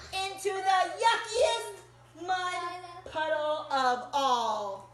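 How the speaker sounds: put-on lively picture-book read-aloud for kids; reading to children. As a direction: tell it like a storybook to little listeners.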